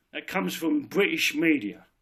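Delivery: ordinary talk, nothing marked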